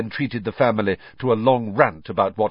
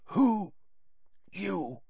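whispered; 10 semitones higher, 6 dB quieter than usual. A narrator's reading. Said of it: "he whispered".

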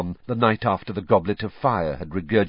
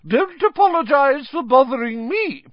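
high, louder, biting sarcasm; irritable fat lady; 5 dB louder than usual. A narrator's reading. The fat lady complaining at the students left wide open, high and sarcastic. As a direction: scold them sweetly and sourly at once. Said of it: "the fat lady called irritably".

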